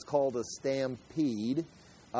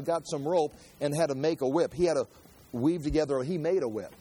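ordinary talk, nothing marked

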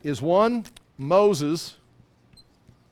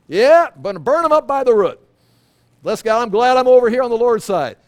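louder, higher, fired-up preaching; preaching shout; 5 semitones higher, 9 dB louder than usual. Real, not acted. Pastor preaching on the Lord scorching the wicked, loud and fervent. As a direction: proclaim it with joy to the congregation.

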